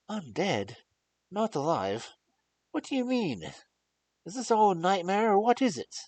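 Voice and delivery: loud, high, alarmed questions; shocked disbelief. Acted. Young lord cries out at the doctor's news. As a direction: reel at the horrible idea.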